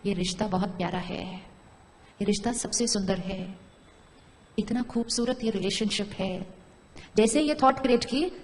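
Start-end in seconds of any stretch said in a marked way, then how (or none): 0.0-6.5 softer, slower, tender blessing; whisper-close, savour each word of love; loving thought. Shown as a demonstration. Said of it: "अब हमने एक thought create करनी शुरू की".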